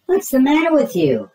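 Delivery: high, loud worried mother; a fussing mom voice; concerned mom. Acted. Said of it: "his mom asked".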